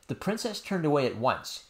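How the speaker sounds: usual speaking voice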